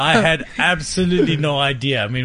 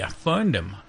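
ordinary delivery

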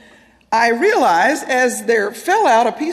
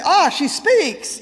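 slow, high, drawn-out sarcasm; mock amazement; 6 semitones higher, much slower than usual. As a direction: announce it with exaggerated, teasing wonder. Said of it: "And he didn't say".

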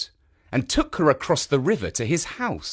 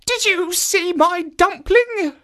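put-on old woman voice; 5 dB louder, slower than usual. Old woman asks statue.

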